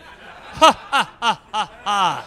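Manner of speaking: loud, spaced-out villain laugh; mock evil laugh stepping down in pitch; end-level boss laugh. Check-in woman as Street Fighter boss, booming evil laugh. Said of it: "end-level boss".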